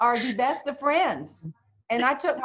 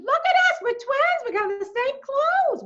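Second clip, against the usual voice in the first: very high, loud, gushing voice; gushing Yankee seatmate; 5 dB louder than usual. Retold or imitated. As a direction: squeal it at your new twin. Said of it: "She said".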